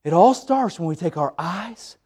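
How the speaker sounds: ordinary talk, nothing marked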